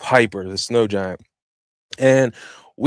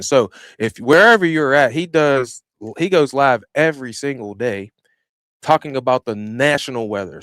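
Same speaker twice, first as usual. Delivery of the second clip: normal talking voice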